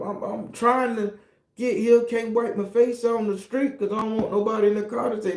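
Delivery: usual speaking voice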